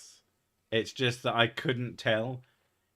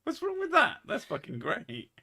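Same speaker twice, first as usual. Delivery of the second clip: mock put-on voice; 4 dB louder than usual. Mock voice banter.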